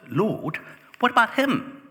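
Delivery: high, loud, whining question; whiny Peter. Acted. Peter points at John and complains to Jesus, high and whining. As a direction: play Peter as a petulant, nosy disciple.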